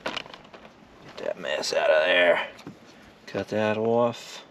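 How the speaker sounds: slow, low, lightly Boston-tinged words; a slipped-in Boston accent, drawn out slowly. Real, not acted. Cooking alone, slipping into a light Boston accent. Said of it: "I just had a Boston accent with that".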